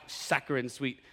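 ordinary delivery

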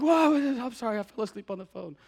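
high, startled yelp of sorry; startled apology. Retold or imitated. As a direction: jump awake and apologize. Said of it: "I'm like".

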